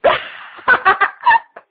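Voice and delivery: a high, loud burst of laughter; laughing. Real, not acted. Comedian laughing at his own barbecue joke. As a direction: cracks up at the joke.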